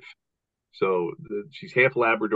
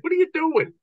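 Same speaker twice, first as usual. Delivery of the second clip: much higher, disbelieving squeal; incredulous squeak; 3 dB louder than usual. Retold or imitated. Telling the host how he reacts to his dog, in an incredulous high squeal. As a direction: play it as shocked disbelief at the dog. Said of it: "And I'm like".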